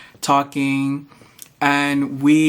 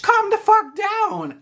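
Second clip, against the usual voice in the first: much higher, slow exasperated yell. Real, not acted. Ranting at sidewalk speeders, exasperated higher yell. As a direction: drag out the outburst in disbelief.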